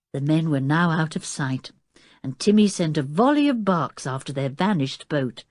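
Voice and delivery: ordinary delivery, nothing marked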